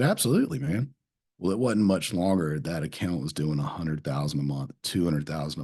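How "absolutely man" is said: high, beaming absolutely; eager yes. Retold or imitated. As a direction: sell the eager yes to a grateful customer. Eager yes to grateful customer.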